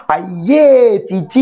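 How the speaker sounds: put-on long drawn-out "yuck" to a toddler; mother's disgusted scolding. Mother to a baby playing in its mess, drawn-out disgust. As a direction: act a mother going eww, stretching it out. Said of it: "ആ സമയത്ത് അമ്മ അത് പറയുന്നുണ്ടാവും".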